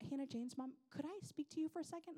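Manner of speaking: high, sugary imitation of a teacher; imitated sweet Southern lady. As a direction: politely ask like a sweet preschool teacher. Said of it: "in her sweet Southern voice".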